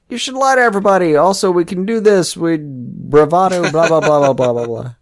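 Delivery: high, fast mock bravado; bad acting. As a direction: ham up a cocky pep talk. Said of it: "kind of the guy that says".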